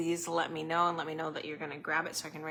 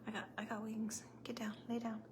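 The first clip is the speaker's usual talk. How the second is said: whispered command; 3 semitones higher, 11 dB quieter than usual. Real, not acted. Telling her dog to lie.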